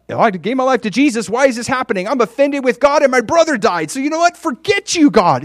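high, loud, indignant whine at God; offended believer. Retold or imitated. Acts out offended believer's complaint.

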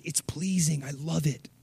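quiet tender pleasure; soft approval. Retold or imitated. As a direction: murmur it like a delighted father. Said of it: "he's like".

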